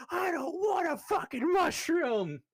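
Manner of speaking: high, slow shrieking refusal; drunk yelling. Acted. James yells at Torvik while staring at reflection. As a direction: scream the refusal.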